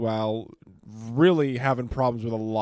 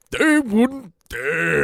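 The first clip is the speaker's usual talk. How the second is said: slow, drawn-out Pacino growl; Al Pacino impression; 6 semitones higher, 6 dB louder, much slower than usual. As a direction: do a big Pacino. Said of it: "that impression of you going".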